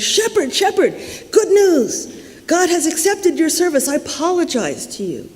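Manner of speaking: high, urgent call with joyful news; excited calling out. Acted. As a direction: call out to the shepherd, then settle into apology. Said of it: "He goes running toward the shepherd".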